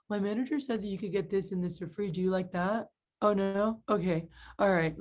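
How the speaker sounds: mock a listless rep giving up; unexcited. Shown as a demonstration. Rep offering customer a deal, unexcited. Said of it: "that's how a lot of you guys do it".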